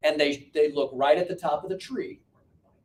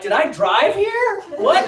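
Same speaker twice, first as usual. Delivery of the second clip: confused disbelief; 7 dB louder, slower than usual. Retold or imitated. Confused self-quote in parking lot.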